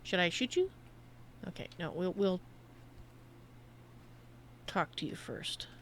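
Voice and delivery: ordinary delivery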